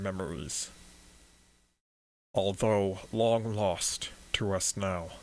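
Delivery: lightly voiced monotone priest. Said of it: "He speaks in this monotone voice".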